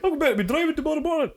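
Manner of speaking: high, fast put-on excuse voice; mock whiny excuse. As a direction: whine it like a lame excuse. Mimicking her own excuse.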